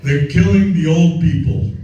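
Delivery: hammering it home. Real, not acted. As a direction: drive the point home harder. Repeats his point, louder second time.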